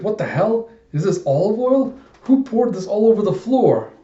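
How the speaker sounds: high-pitched, indignant outburst; acted outrage. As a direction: demand to know who made this mess. Slipped character complains about spilt oil.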